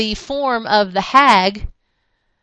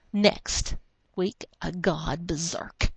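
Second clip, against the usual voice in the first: soft, slow whispered cliffhanger; dramatic teaser whisper; 5 semitones lower, 11 dB quieter, slower than usual. Acted. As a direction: hush it like a next-issue teaser. Reading comic's closing caption.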